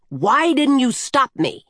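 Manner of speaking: high, indignant cry of reproach; shouted. A narrator's reading. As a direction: shout it accusingly, pitch jumping up. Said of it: "she shouted".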